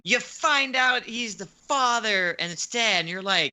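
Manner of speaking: ordinary talk, nothing marked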